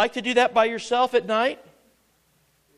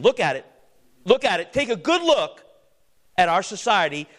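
forceful command; 7 dB louder than usual. Acted. Preacher urges congregation to look.

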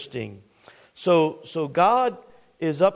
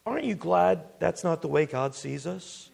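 ordinary talk

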